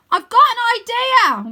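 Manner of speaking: high, loud confident shout; big high cartoon shout; Wyn shouting. A narrator's reading. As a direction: call it out proudly from above. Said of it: "Wyn shouts".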